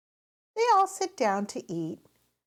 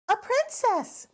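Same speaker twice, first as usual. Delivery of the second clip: high, eager burst; a little girl blurting out her idea; excited interruption; 13 semitones higher, 3 dB louder than usual. A narrator's reading. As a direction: jump in with the idea, thrilled. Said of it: "Peppa jumps in".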